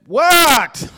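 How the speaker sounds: loud, high-pitched shouted "what"; retold shout. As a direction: holler back downstairs, irritated at being called. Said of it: "I shouted back down".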